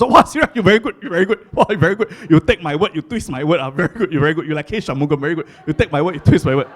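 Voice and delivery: loud sarcastic praise; hammed-up backhanded compliment; mock praising friends. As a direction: Chinese friends mockingly praising Siraj. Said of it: "my Chinese friends".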